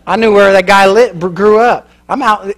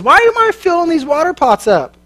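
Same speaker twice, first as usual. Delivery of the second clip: doubtful whine; 9 semitones higher than usual. Acted. Voicing presumption doubting.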